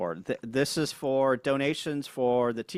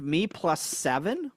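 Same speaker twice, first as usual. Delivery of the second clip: ordinary talk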